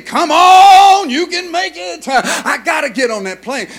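high, loud urging; root for the driver like at a race; cheering on. Retold or imitated. Preacher retelling how he cheered the van driver through traffic.

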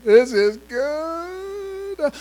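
very high, very slow jeer; mocking high drawl. Jericho onlookers gloat at the marchers, high and slow. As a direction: stretch it out in a high sneer.

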